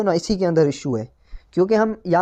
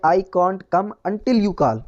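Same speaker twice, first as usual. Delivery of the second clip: slow dictation; much slower than usual. Shown as a demonstration. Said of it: "इसका correct version है".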